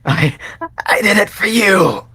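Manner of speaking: high, loud manic shout; desperate high yell. Acted. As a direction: scream the confession, unhinged. Dying villain's manic confession.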